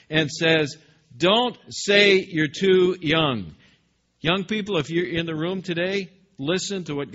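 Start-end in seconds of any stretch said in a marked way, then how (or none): 1.2-3.5 slow, loud, measured parental scolding; stern parent. Acted. God scolding Jeremiah like a stern parent, slow and loud. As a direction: correct a child firmly. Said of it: "stern-sounding parental voice".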